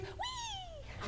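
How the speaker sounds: tiny high, soft squeal; a soft, high-pitched little "wee"; cute "wee". Acted. Imitating cute ladies with arched backs, cute high wee. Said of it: "we're like".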